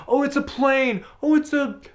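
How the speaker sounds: high, piping gawking voices; amazed onlookers. Pretend. People watching him fly by on a carpet, high awed voices. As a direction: gasp up high like a crowd spotting something. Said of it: "People would be like".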